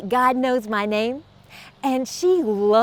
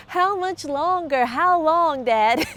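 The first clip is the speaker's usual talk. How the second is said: put-on higher, drawn-out child's whine; impatient kids; 4 semitones higher, slower than usual. Kids asking dad the whole day on the trip, high impatient whine. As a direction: whine it slowly like a bored kid. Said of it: "we'd ask my dad the whole day".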